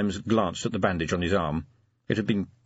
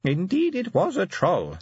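high, thin elder voice; thin old voice, assured; 12 semitones higher than usual. A narrator's reading. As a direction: agree high and old, a little surer. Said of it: "but with a little more assurance".

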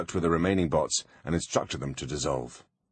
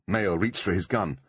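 ordinary delivery, nothing marked

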